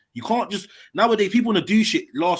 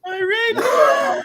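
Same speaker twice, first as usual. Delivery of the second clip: high, slow shout of reload; shouted demand; 4 dB louder, much slower than usual. Real, not acted. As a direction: scream it, dragging the word out. Shocked, demanding the clip replay.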